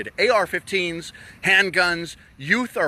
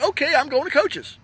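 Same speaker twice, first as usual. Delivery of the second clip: breezy shrugging self; 10 semitones higher than usual. Retold or imitated. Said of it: "And I would have been like".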